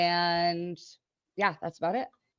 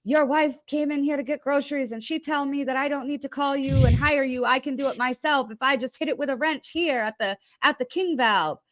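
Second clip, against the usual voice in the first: higher-pitched mock complaining customer; little prank-caller voice; 7 semitones higher than usual. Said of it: "[name]'s with his little voice".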